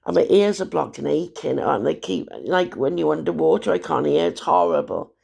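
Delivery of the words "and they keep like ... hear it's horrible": normal talking voice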